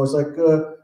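ordinary delivery

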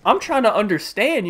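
ordinary delivery

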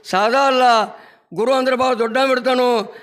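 ordinary talk, nothing marked

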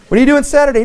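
hammed-up bait, laughing; high giggly mock invitation; laughing fake-casual ask. Mock dialogue about moving help.

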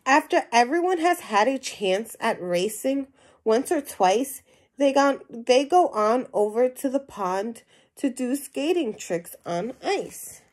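lively storybook reading to a class; animated read-aloud voice for young listeners; reading to children. Acted. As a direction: read it out like a picture book for kids. Teacher reads picture book aloud.

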